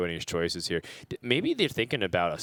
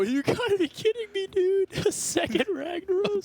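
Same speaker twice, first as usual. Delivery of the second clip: very high-pitched disbelieving yelp; incredulous outburst. Real, not acted. Caster seeing a second Ragnaros, incredulous outburst. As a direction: squeal it in laughing disbelief.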